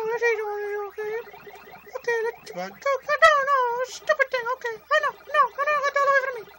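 very high falsetto squeak; squeaky voice. Pretend. Player as the game character fleeing ghosts, squeaky voice. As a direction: squeal it loudly in a tiny cartoon voice. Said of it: "it's the squeaky voice".

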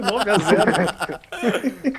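drawn out through laughter; laughing. Real, not acted. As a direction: stretch the words while laughing. Laughing repeat of the score.

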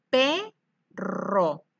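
"perro" drawn out, the "rro" stressed; syllabifying perro. Shown as a demonstration. Teacher showing the class the last syllable of "perro". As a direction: say "perro" slowly, leaning on the rolled "rro". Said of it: "Dice ro".

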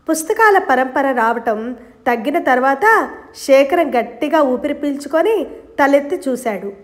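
plain reading voice

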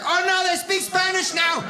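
fleeing chef in mock alarm; mock panic.

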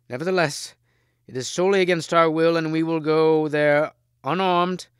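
high, loud declaration; forceful protest. A narrator's reading. Brothers obeying the king against their will, protesting loudly. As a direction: object forcefully, drawing out the final word.

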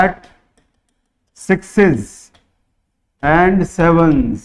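"and sevens" drawn out very slowly; slow dictation. Shown as a demonstration. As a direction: dictate word by word with long pauses. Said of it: "अब इसको दोबारा लिख रहा हूँ". (रुक-रुक कर धीमे लिखवाना)

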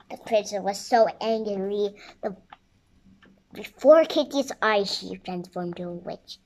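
ordinary delivery

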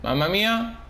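ordinary talk, nothing marked

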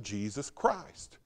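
ordinary talk

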